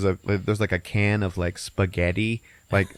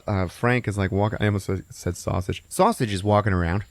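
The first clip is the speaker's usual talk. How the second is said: ordinary talk, nothing marked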